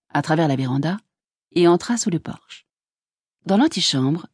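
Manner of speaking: ordinary delivery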